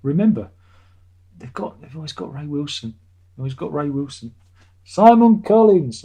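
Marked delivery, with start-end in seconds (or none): 4.9-6.0 loud, high, drawn-out name announcement; emphatic proclamation. Real, not acted. Putting forward a new singer for the band, emphatic proclamation. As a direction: proclaim the name slowly and loudly.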